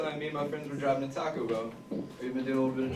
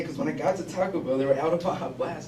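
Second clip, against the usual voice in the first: ordinary talk, nothing marked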